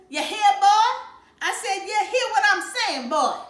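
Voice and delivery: playful exaggerated Southern hillbilly accent, loud and high. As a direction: bellow it like a mocking Southern hillbilly. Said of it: "Dad did an imitation of a hillbilly accent".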